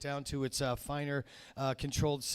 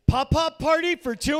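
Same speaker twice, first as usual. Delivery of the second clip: high, loud, slow host call; host calling names; 12 semitones higher, 13 dB louder than usual. Retold or imitated. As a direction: call the name across the room. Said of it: "you hear the guy go".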